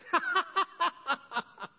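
high, hammed-up mocking laugh; mocking laughter. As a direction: imitate a crowd laughing in ridicule. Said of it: "they're laughing, they're making fun".

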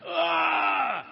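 a pained grunt from a gut punch, pitched high; winded grunt. Retold or imitated. Driver hit in the stomach by his wife, winded pained grunt. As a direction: let the punch knock a grunt out of you.

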